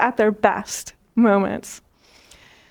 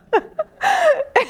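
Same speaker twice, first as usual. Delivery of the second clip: high delighted laugh. Real, not acted. Laughs at her own kangaroo story.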